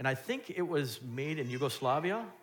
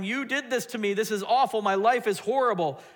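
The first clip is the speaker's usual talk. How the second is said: loud, higher, angry cry at God; yelling at God; 8 semitones higher, 7 dB louder than usual. Retold or imitated. Congregant yelling at God in anger, loud mock complaint. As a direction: rail at heaven in mock anger. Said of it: "When we're yelling at God".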